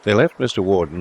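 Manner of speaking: ordinary delivery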